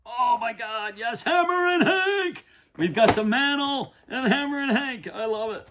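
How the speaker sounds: high, loud elated cry; fired up. Real, not acted. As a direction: savor each name like a trophy. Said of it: "I'm pretty fired up".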